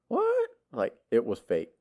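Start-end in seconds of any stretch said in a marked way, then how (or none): 0.1-0.5 high squeaky disbelieving what; surprised what. Retold or imitated. Retelling finding the bus stop was real, startled. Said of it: "I just like".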